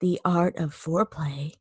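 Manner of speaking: soft, breathy and low. Shown as a demonstration. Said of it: "You have to say it like that".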